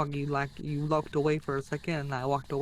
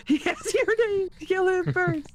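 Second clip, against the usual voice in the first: high, loud gleeful shout; excited; 14 semitones higher, 6 dB louder, slower than usual. Real, not acted. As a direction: egg it on with glee. Laughing, egging on the ghost.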